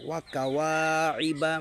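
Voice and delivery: ওয়া কাওয়াইবা slowly, waw drawn out; lengthened waw. Shown as a demonstration. Teacher showing the lengthened vowel slowly. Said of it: "এখানে ওয়াওটা বাড়াচ্ছি".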